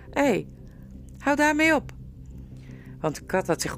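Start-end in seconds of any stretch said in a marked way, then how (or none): 0.1-1.9 startled protest. Acted. Cat scratches the boy.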